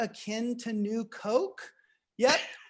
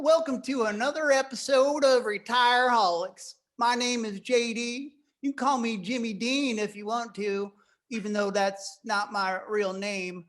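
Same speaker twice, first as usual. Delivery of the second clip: a California guy faking a Texas twang; makeshift Texas accent. Pretend. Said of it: "that little makeshift accent".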